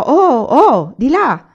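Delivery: loud, high call to the oxen; shouted command. Acted. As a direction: bellow at the oxen to turn. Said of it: "L'uomo gridò".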